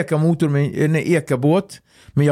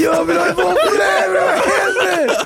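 laughing. Retold or imitated. Joking that as a Swede he just wants pancakes, giggling. Continues silly self-quote, laughing.

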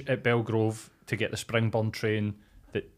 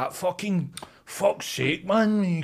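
slow, higher exasperated groan; mock aggrieved guy; 6 semitones higher, much slower than usual. Woman mimicking an offended man, slow and whiny. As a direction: drag it out like a put-upon bloke. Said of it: "he'd be like".

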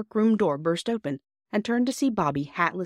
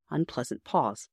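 plain reading voice

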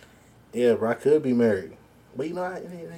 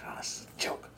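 whispered; 16 dB quieter than usual. Retold or imitated. His own retold reply.

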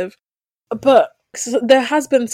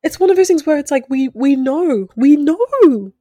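louder, higher, weary exasperation; exasperated; 5 semitones higher, 5 dB louder than usual. Real, not acted. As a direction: push out the tiredness, we know already. Exasperated at being sent images.

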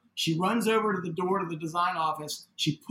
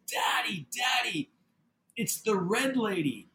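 put-on high child voice, slowing at the end; imitating young daughter; 4 semitones higher, slower than usual. Daughter tells father what she sees, imitated high voice. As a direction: imitate a child urgently telling Dad, pitched up. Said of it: "She says".

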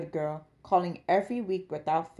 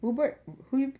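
ordinary delivery, nothing marked

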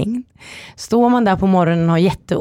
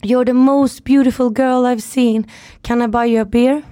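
flirting stranger; 6 semitones higher than usual. Retold or imitated. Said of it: "Han bara".

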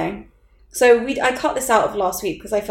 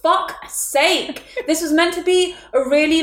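exasperated outburst; 7 semitones higher than usual. Retold or imitated. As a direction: drag it out in exasperation. Dog hair on new veil.

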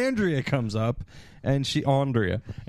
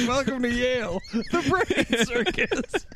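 mock sign announcement; 8 semitones higher than usual. Reading an imagined sign.

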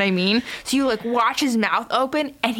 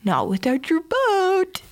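higher, slow, creepy taunt; Pennywise; 7 semitones higher, slower than usual. Retold or imitated. Clown stops the boy leaving, slow creepy lure. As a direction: draw it out in a teasing clown voice. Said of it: "And Pennywise is like".

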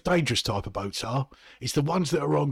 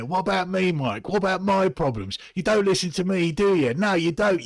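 higher, louder self-pitying rant; whiny outburst; 6 semitones higher, 3 dB louder than usual. Acted. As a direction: complain at him, pitched up and loud.

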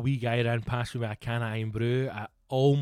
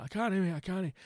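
higher, lightly American refusal; a vendor apologetically turning him down; American stallholder; 6 semitones higher than usual. Retold or imitated. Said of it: "obviously he's not Scottish".

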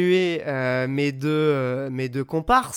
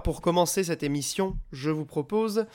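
normal talking voice

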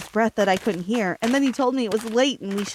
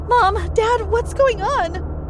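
high, slow panicked plea; frightened cry; 10 semitones higher, slower than usual. A narrator's reading. Daughter crying out to parents in panic, high slow plea. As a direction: cry out to parents, scared and lost. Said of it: "I cried".

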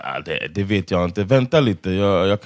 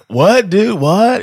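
higher puzzled surfer voice; lightly voiced surfer dude, pitched up; 7 semitones higher, 3 dB louder, much slower than usual. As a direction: play a confused surfer dude. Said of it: "it sounded like a surfer dude".

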